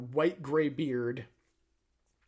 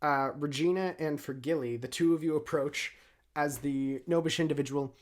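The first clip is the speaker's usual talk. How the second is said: ordinary talk, nothing marked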